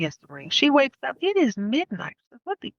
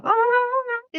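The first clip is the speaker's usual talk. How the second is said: alarmed denial. Retold or imitated. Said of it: "She's like".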